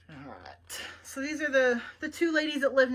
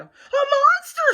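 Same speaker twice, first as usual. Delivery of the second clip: a much higher, much louder slow scream of fright; terrified shout; 13 dB louder, slower than usual. Acted. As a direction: scream as if a monster is at the window. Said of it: "shouted Baby".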